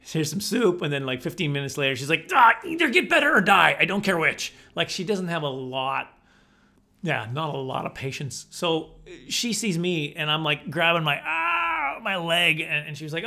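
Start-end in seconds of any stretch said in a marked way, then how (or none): 2.3-4.5 high, loud mock wife; impatient wife. Wife losing patience with sick husband, loud mock impatience. 11.2-12.6 mock cry of pain, much higher on ah; pained yell. Husband grabbing bashed knee, hammed-up pained yell.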